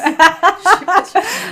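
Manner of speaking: loud laugh. Real, not acted. Laughs at mishearing.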